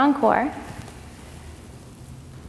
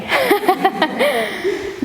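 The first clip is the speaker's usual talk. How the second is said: short bright laugh; light laugh; 10 semitones higher than usual. Real, not acted. Laughs at the class.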